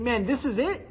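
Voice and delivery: picture Peter thrilled the moment has come; breathless, rising hype as Peter; imagined Peter's excitement. Retold or imitated. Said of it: "I wonder if he's thinking".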